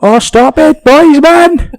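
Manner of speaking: high-pitched, loud mock outrage; exasperated yelling. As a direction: yell at the boys, at your wits' end. Acted row with boys.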